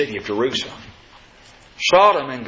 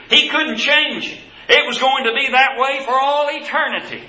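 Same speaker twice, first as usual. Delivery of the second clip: raised-pitch pulpit proclamation; emphatic preaching; 9 semitones higher than usual. Real, not acted. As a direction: preach it hard, stretching the key words out. Preacher drives the point home.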